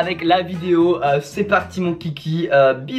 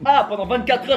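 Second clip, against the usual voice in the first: ordinary talk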